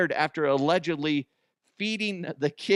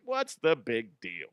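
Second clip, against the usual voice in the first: dismissive shrug; 4 semitones lower than usual. Retold or imitated. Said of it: "And I'm like".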